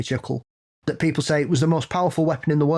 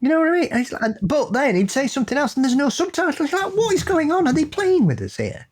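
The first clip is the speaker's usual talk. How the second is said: exasperated disbelief; 13 semitones higher than usual. Real, not acted. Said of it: "exasperated rant".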